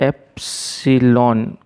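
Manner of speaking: एप्सिलॉन slowly in syllables, ep-si-lon; showing pronunciation. Shown as a demonstration. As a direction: sound out एप्सिलॉन with a full "lon" at the end. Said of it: "इसको इस तरह से पढ़ते हैं". (एप्सिलॉन को तोड़कर धीरे से: एप-सि-लॉन)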